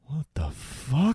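slow, soft, drawn-out shock; stunned disbelief. Retold or imitated. Key reacting to the wink, slow stunned disbelief. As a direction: let the disbelief hang, quietly. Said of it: "And Key's like".

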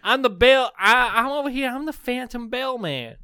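high, loud Texas twang; Phantom Bell man. Pretend. Ghost bellman introducing himself, in a loud southern accent. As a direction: announce yourself in a hammed-up southern accent. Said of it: "He speaks like a southern debonair".

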